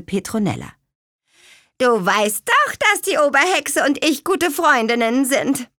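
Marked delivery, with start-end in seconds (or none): 1.8-5.7 boastful witch. A narrator's reading. Hexubine boasting.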